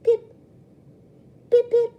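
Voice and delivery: very high, loud bird chirps; child faking a bird call from hiding. A narrator's reading. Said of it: "Rue chirps beside me".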